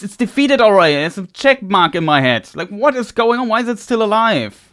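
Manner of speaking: annoyed gamer shouting at the screen; angry rant. Real, not acted. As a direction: vent at the enemy that won't die. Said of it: "Sorry for getting so angry".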